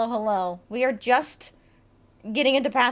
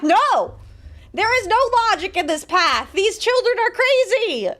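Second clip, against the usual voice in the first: loud, higher, fed-up outcry; louder and higher for the last line, slowing down; exasperated shout; 8 semitones higher, 8 dB louder than usual. Acted. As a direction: throw up your hands and yell it. NPC Robert exasperated outburst.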